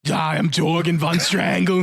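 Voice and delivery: slow, drawn-out strongman introduction; Jorgen von Strangle. Retold or imitated. Fan impersonating cartoon character for co-hosts, slow strongman voice. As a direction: announce yourself slowly as a cartoon muscleman.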